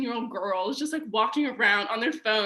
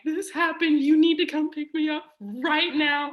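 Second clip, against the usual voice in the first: pleading; 5 semitones higher, 4 dB louder than usual. Retold or imitated. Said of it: "And I was like".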